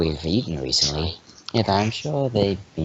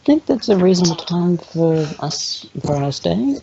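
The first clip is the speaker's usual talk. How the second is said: pitched-up voice for the character; high character voice; 10 semitones higher than usual. Acted. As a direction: play it high and sweet. Character voice in the game.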